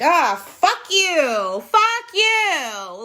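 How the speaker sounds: loud, high, drawn-out cursing; mock outraged retort. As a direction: hurl it back with long stretched vowels. Retorting to being told off.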